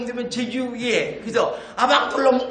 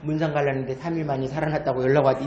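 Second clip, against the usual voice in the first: low, fast standard Korean; standard Korean version; 7 semitones lower, much faster than usual. Shown as a demonstration. Speaker translating the punchline, in quick standard Korean. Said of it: "표준 말로 하면".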